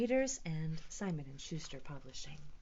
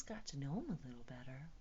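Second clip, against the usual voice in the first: whisper; 8 semitones lower, 12 dB quieter, much faster than usual. Real, not acted. Whispered end.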